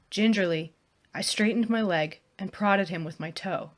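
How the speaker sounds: ordinary delivery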